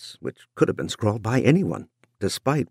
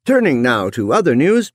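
newscaster voice; 5 semitones higher, 4 dB louder than usual. A narrator's reading. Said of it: "Newscaster Hunter paused ... and said".

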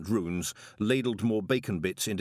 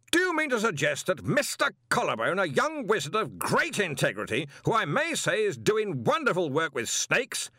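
sudden fury; 9 semitones higher than usual. A narrator's reading. Arch-Chancellor rebukes the intruder in his own college, furious. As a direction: put him firmly in his place. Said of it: "roared Ridcully".

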